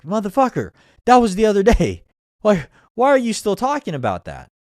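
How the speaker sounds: loud, high, exasperated callout; exasperated shout. Retold or imitated. Calling out a friend who still holds a grudge, loud exasperated shout. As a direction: yell it like you can't believe they're still on about it. Mocking someone still angry.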